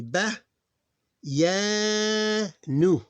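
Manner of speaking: ba, ya, nu called out high, ya held long; teacher spelling out syllables; sounding out. Shown as a demonstration. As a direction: sound out slowly. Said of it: "these two here".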